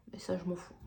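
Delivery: soft whisper; whispered aside. Real, not acted. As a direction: whisper it under breath.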